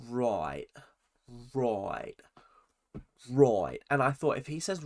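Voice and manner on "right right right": low, drawn-out Australian "right"; mock-Australian repeated verbal tic; Jordan. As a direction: imitate an irritating Australian, dropping each word. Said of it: "Jordan kept on saying".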